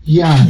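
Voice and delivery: یعنی as yaani, first vowel held; no glottal stop in یعنی, just a long a; lengthened vowel. Shown as a demonstration. Linguist summing up the sound change, lengthened vowel.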